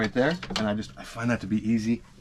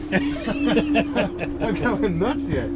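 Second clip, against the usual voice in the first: laughing; 8 semitones higher, much slower than usual. Real, not acted. Laughing at own forgetfulness.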